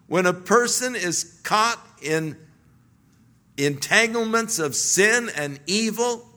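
usual speaking voice

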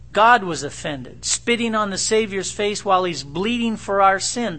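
ordinary talk

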